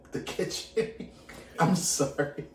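breaks into laughter; laughing through the words. Real, not acted. Laughs mid-sentence.

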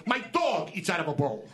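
usual speaking voice

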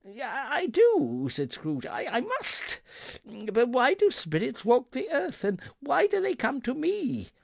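put-on high, trembling old man; frightened pleading. A narrator's reading. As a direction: stammer out in fear. Terrified Scrooge pleads.

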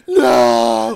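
high, pained drawn-out cry of no; retold yell. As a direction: wail it high like a desperate mock cry. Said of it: "I'm yelling".

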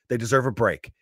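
ordinary delivery

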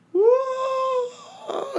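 high falling scream; falling cry. Retold or imitated. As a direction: wail like a falling man.

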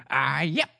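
high, drawn-out little alien voice; Babu Frick. Retold or imitated. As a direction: attempt a tiny droid mechanic's voice, rising high and slow. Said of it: "I can't do the voice".

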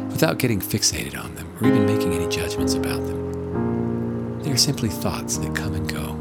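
hushed and calm, guiding relaxation. Acted. Leads a guided meditation.

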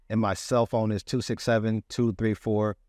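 usual speaking voice

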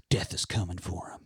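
low, quiet menacing threat. Retold or imitated. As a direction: drop to a dark hush. Menacing threat in retold scene.

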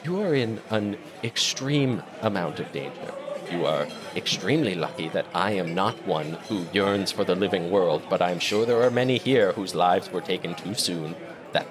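lightly voiced ghost warning them to go; Millie the ghost. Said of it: "and is like".

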